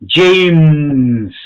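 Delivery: James said loudly, English dj and a clear final m; English pronunciation. Shown as a demonstration. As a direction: model James slowly for the class to repeat. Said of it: "Se dan cuenta la diferencia".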